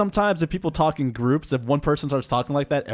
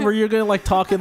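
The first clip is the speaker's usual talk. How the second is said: normal talking voice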